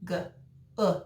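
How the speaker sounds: "G, uh" as two short isolated sounds; segmented "G, uh", each sound on its own. Shown as a demonstration. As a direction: sound out "G, uh" with a gap after the consonant. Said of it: "what do we have?".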